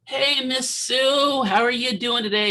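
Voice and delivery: high, slow and timid hello; meek greeting. Retold or imitated. Nervous student greeting a grumpy patient, meek and high. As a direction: tiptoe in with a nervous, overly polite hello. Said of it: "I kinda meekly walk in".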